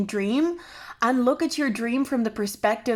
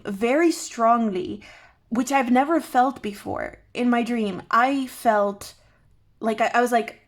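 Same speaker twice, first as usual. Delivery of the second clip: usual speaking voice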